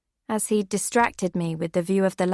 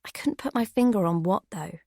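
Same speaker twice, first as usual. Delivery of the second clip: usual speaking voice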